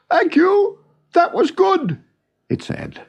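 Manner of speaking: high, odd, friendly bird voice; a huge bird politely thanking its host; giant bird's voice. Acted. The giant bird, full after its feast, to Noggin: high, odd, friendly voice.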